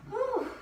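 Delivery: high breathy whew of relief; relieved whew. Real, not acted. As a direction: blow out in relief. Exercise just finished.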